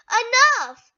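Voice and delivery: high, loud shout. A narrator's reading. Elsa losing control with her sister, shouting high and loud. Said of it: "as she shouted".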